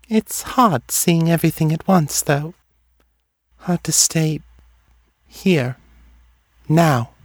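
high, detached voice with long pauses; dreamy seer. A narrator's reading. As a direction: let each phrase drift out separately, pitched high.

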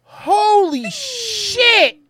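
high, slow, amazed exclamation; stunned disbelief. Real, not acted. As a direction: gasp it out, truly floored. Reacting to bullet hitting wheel.

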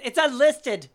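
loud, high-pitched sassy comeback; smart-mouthed retort. Retold or imitated. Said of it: "I am such a smart mouth".

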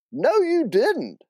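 high, drawn-out contradiction; exasperated aunt insisting; insistent. Acted. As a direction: insist in a slow, high Southern voice. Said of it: "Hallie insisted".

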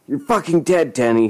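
high, angry threat; spat threat. A narrator's reading. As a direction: spit the threat out. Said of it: "he spat".

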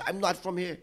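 a hint of a posh English actor's voice; English accent. Retelling the actor talking to the cop, light English accent. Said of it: "He straight went into that English accent".

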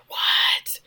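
breathless whispered surprise; whispered shock. Retold or imitated. Ty in bed whispering back to Shauna, shocked whisper. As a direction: hiss the question under your breath. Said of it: "they're whispering".

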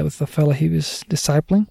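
normal talking voice

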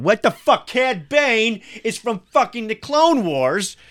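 high, squeaky, outraged fan voice; shrill mock-indignant listener; mock angry nerd. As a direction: squeal like a pedantic fan correcting you. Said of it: "before anyone listening is going".

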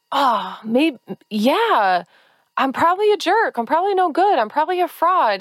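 high, resigned self-doubting voice; mock defeated self-talk. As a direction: give in to the inner critic. Inner voice agreeing with critic.

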